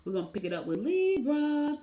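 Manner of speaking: normal talking voice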